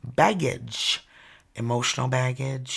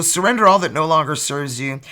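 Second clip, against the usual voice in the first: reading a card message; 6 dB louder than usual. Acted.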